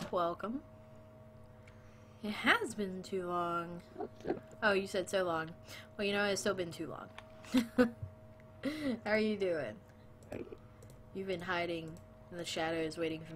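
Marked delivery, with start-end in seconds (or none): none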